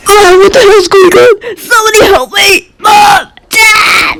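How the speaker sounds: high-pitched, wailing shouts for help; panicked cry for help. Acted. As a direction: scream for Mom and Dad in panic. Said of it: "And I went".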